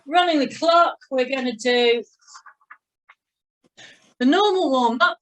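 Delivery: loud, high call to the class. Real, not acted. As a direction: announce it like a coach over music. Instructor calling out to class.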